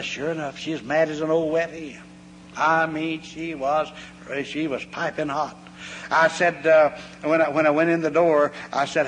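ordinary talk

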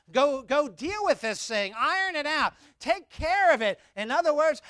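impassioned preaching. Acted. Pastor urging the congregation to communicate, high and loud. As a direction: drive each word home like a preacher.

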